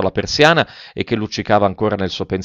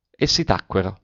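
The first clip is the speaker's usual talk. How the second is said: ordinary delivery, nothing marked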